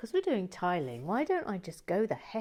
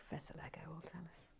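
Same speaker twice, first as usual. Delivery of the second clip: whisper; 2 semitones lower, 18 dB quieter, much faster than usual. Real, not acted. Repeated in whisper, then aloud.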